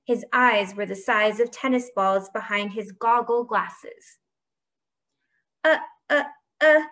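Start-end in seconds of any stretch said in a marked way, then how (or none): 5.6-6.8 loud, higher stammered uhs; sputter, caught out; flustered false starts. A narrator's reading. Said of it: "he sputtered".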